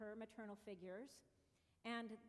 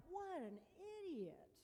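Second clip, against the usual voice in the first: slow, high disgusted remark; self-critical inner voice after the mistake; self-scolding; 8 semitones higher, slower than usual. Retold or imitated. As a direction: call yourself an idiot with disgust. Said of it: "I feel the pang of regret".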